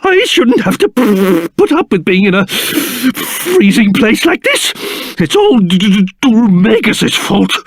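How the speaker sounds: teeth-chattering, stuttering complaint; shivering with cold. Acted. King grumbling in a freezing place, shivering and stuttering. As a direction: shudder and stammer through the freezing cold. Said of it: "I don't think I've ever been so c-, cold".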